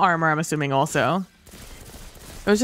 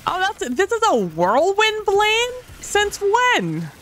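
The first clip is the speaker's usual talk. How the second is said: surprised; slower than usual. Real, not acted. Surprised at weapon name.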